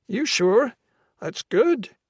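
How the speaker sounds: high, loud Irish brogue; Irish brogue, pushy; thick Irish brogue. A narrator's reading. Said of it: "in a thick brogue".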